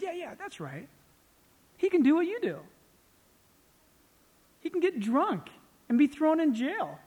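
acted sarcasm, punching the key words; sarcastic retort.

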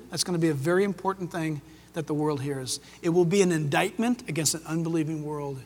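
ordinary delivery

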